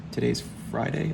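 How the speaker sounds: ordinary talk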